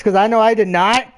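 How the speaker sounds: usual speaking voice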